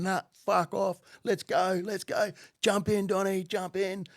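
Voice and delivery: high, insistent shouted urging; urgent hollering. Retold or imitated. Driver refusing to get out, calling Donnie in, urgent hollering. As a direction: imitate a frantic driver ordering everyone in.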